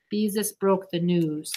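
ordinary delivery, nothing marked

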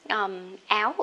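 usual speaking voice

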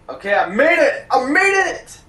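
high, loud triumphant cheer; excited cheer. Real, not acted. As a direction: celebrate the escape.

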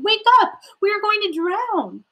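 high-pitched panicked plea; urgent high alarm, loud at first; terrified cry. A narrator's reading. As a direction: cry out in acted storybook terror. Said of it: "the disciples were terrified".

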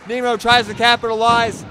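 excited play-by-play call; wrestling commentator. Real, not acted. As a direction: call the move loudly with excitement. Excited call of a big move.

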